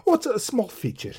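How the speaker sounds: high, fluttery and evasive; nervous little man. A narrator's reading. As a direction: brush it off with a nervous titter. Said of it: "tittered nervously".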